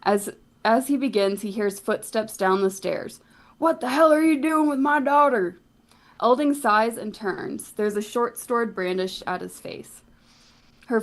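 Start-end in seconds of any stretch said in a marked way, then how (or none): 3.6-5.6 higher, gruff angry father voice; a father bursting in on his daughter. Acted. Shopkeeper catching the priest with his daughter, angry.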